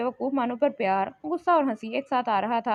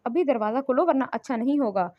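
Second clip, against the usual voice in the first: ordinary delivery, nothing marked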